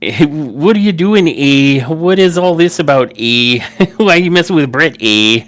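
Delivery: put-on play-scolding in a Canadian accent; mock Canadian accent. Podcaster mimics Sami scolding Gunther, in a mock Canadian accent.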